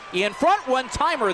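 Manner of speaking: excited play call. Real, not acted.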